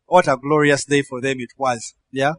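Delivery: ordinary talk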